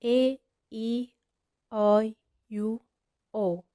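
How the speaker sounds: each vowel letter said slowly and clearly; spelled vowel letters. Shown as a demonstration. Teacher spelling out the vowels to a student, slow and clear. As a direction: spell out A, E, I, U, O one by one. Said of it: "خمس حروف".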